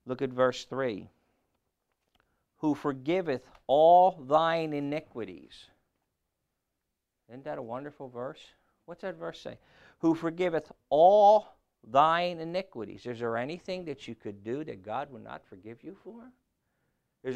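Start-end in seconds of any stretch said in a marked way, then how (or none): 2.6-5.7 loud, slow proclamation; scripture reading. Acted. Preacher reading verse three, slow loud proclamation. 10.0-13.0 loud raised proclamation of the verse; scripture reading, higher. Acted. Preacher repeating the verse to the congregation, raised and loud.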